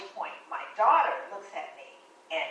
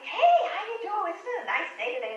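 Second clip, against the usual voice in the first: imitating the checker's high, cheery hello; chirpy small talk to a customer; bright friendly greeting; 10 semitones higher, 4 dB louder than usual.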